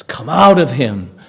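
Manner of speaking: loud, authoritative rebuke; forceful command. Acted. Jesus to the evil spirit, a loud command. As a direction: order it out with sudden force.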